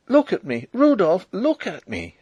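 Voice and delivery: high, loud plea; crying out. A narrator's reading. Princess pleading with Rudolf, crying out. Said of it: "she cried".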